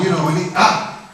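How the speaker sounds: ordinary delivery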